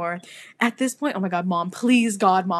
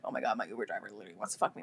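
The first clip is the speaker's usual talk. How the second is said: rushed inner thought; 11 semitones lower, 10 dB quieter, much faster than usual. Retold or imitated.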